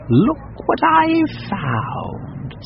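high, very slow showing-off cat; pleased cat. Acted. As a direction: present the rat like a prize. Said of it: "said the cat".

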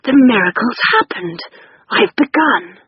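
hoarse, lightly elated announcement; hoarse but happy. A narrator's reading. Father announcing he has begun writing, hoarse and happy. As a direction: cry it hoarsely, with delight. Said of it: "hoarsely but happily".

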